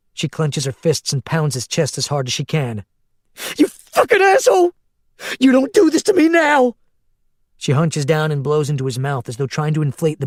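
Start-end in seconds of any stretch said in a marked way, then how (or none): 3.6-6.8 high, loud raging yell; grieving rescuer cursing the dying man; furious scream. A narrator's reading. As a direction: scream at him as you pound his chest. Pounding his chest in rage.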